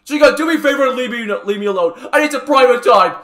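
high, loud strained dismissal; worked-up, tense demand; pained irritation. Acted. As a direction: send the culprit away while hurting. Hurt patient sends helper away.

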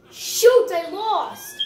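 high laughing shoot; laughter bursting out; stifled giggles. Real, not acted. Loses the no-laugh challenge.

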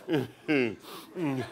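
ordinary delivery

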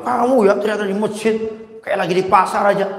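high, loud mocking rebuke; mock scolding. Man scolds a shouter in the mosque, mockingly loud. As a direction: shame him for yelling like at the market.

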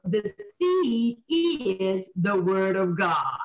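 very slow, drawn-out verse; deliberate, weighty Bible quotation; emphatic scripture quote. Acted. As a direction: stretch each key word of the verse.

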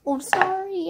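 ordinary talk, nothing marked

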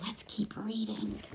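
hushed whispered aside; soft conspiratorial whisper. Real, not acted.

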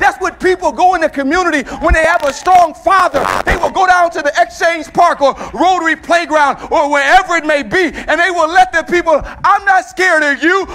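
preacher shouting defiance to the congregation; full-force preaching. Acted. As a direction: shout it out like a proud child of a strong father.

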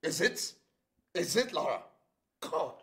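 high-pitched, aggrieved questioning; frustrated outburst. Acted. Rants about being an outcast.

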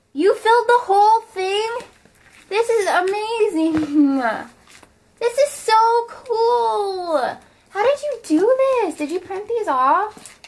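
sincere thrilled disbelief; overwhelmed excitement. Real, not acted. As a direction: gush with real amazement. Overwhelmed by a gift.

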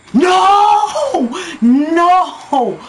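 2 high, loud cry of no; 3 scream it at the screen; 4 gamer's anguished shout; 1 real anguished shout. Real, not acted. Opponent scores, gamer yells.

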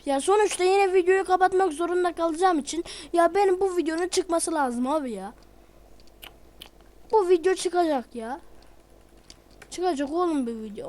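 pushing back hard against the noise ruining the recording; angry rant. Real, not acted. Youtuber fuming at background noise, real angry shouting.

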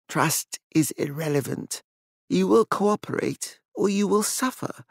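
soft, icy menace; cold threat. A narrator's reading. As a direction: threaten quietly. Elizabeth coldly threatens.

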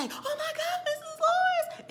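high-pitched mock alarm; panicked shriek. Handmaid discovering Missus Lawrence, shrieked in alarm. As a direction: cry out as if finding a body.

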